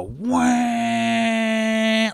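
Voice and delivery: long, high imitated horn blare; car horn honk. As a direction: imitate a driver leaning hard on the horn. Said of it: "I hear a horn just go".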